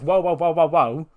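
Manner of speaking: alarmed interruption. Acted. Wrestler cutting in on a rival's claim, alarmed. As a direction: butt in loudly to halt them.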